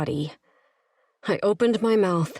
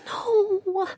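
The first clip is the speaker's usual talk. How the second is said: anguished no. A narrator's reading. Refusal, she says whispered.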